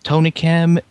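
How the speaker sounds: ordinary delivery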